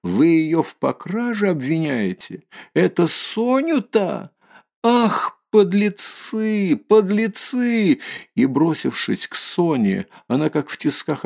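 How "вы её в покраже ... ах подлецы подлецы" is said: high indignant disbelief building to drawn-out wails; acted fury, pitch climbing steeply; outraged crying out. A narrator's reading. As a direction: rise from shocked questioning to stretched, high-pitched condemnation. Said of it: "вскрикнула вдруг, опомнившись".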